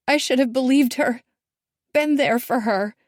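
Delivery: blaming herself; anguished. A narrator's reading. Daughter gazing at the water, anguished.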